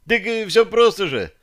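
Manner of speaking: high, breezy shrug of a voice; shrugging merchant. A narrator's reading. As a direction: toss it off like it's obvious. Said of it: "Сверид пожал плечами".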